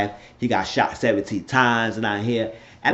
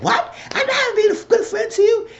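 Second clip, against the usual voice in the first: very high-pitched indignant retelling; loud and hype. Retold or imitated. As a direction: shoot up into a high, outraged mock yell. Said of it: "He did get started getting loud and hype".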